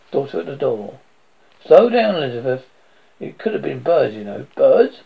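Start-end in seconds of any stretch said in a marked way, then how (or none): 1.7-2.7 1 commanding. Acted. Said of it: "Command from Johnson". 4.5-5.0 sharp, loud rising disbelief; incredulous. Acted. Women repeating the captain's guess, loud incredulous question. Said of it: "repeated the word incredulously".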